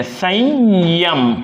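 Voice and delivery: संयम stretched very slowly, each sound separate; teacher sounding out संयम; slow spelled-out demo. Shown as a demonstration. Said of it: "विस्तृत रूप है ये ऐसे है".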